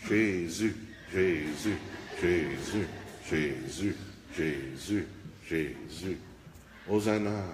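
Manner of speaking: low, soft and fading calls of Jesus; a limp, half-hearted cheer; timid mock acclaim. Shown as a demonstration. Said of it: "Pensez-vous que ça avait de l'air de ça?". (acclamation molle, basse et de plus en plus faible)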